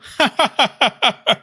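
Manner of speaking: high, loud imitated chortle; exaggerated fake chortle; mocking chortle. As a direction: mimic his chuckle in mocking bursts. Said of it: "Listen to him chortle".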